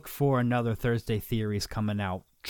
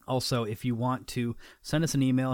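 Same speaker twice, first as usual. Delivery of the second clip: usual speaking voice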